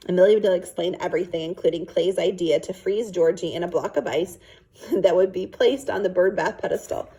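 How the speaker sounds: ordinary delivery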